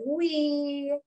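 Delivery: wee sung out high; a gleeful whee down the slide. Acted. Reading the slide page to a toddler, high gleeful wee. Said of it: "When I go down a slide".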